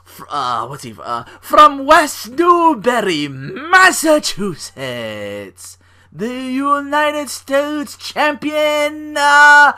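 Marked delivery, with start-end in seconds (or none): 1.4-9.7 much higher, louder, very slow booming intro; imitating an over-the-top WWE entrance call; wrestling ring announcer. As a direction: stretch every word like a ring announcer hyping the champ. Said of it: "she'd murder that".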